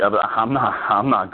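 retold stammering refusal; imitated stutter. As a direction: trip over the first words, mimicking a stutterer. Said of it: "He just started stuttering".

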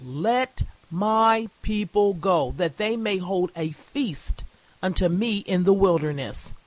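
emphatic pulpit proclamation; declaimed scripture reading. Acted. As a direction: proclaim it to a congregation with spaced phrases.

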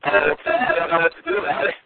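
bad Bluetooth line, drowned sound; underwater phone voice. Real, not acted. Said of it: "you sound like you're in a tunnel underwater".

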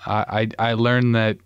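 usual speaking voice

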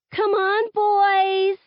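high, loud teasing jeer; taunting. A narrator's reading. Maya taunting the boys during a scrimmage, loud jeer. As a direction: goad the boys across the court. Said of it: "Maya taunts".

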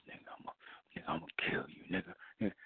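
fuming threat whispered under the breath; under his breath. Retold or imitated. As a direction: hiss a threat so nobody hears. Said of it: "under his breath".

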